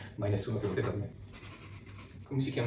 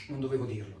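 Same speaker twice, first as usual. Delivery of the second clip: ordinary talk, nothing marked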